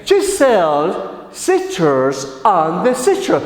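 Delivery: slow, measured, word by word; tongue-twister model. Shown as a demonstration. Teacher giving the English after the translation, slow careful model. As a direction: separate the words for learners to copy. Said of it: "In English we say".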